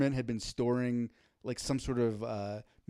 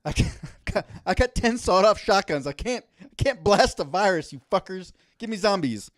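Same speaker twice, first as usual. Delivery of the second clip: loud, higher-pitched mock Montana accent; 5 semitones higher, 7 dB louder than usual. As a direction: rant like a prepper in a hammed-up Montana accent. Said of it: "I give them that accent".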